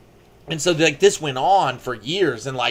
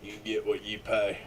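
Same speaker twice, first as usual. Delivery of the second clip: muttered slogan; 6 semitones lower, 11 dB quieter than usual. Pretend. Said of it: "repeating slogan".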